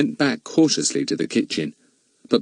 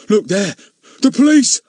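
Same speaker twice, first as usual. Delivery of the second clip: high, loud cry of alarm; 10 semitones higher, 6 dB louder than usual. Acted. Crook spotting the policeman, crying out in alarm. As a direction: yelp out in sudden fright. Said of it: "he cried in alarm".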